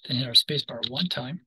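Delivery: normal talking voice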